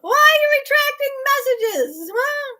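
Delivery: high, loud mock wail; playful protest. Real, not acted. Streamer teasing viewer over retracted message, playful protest. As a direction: wail it teasingly, fresh from laughing.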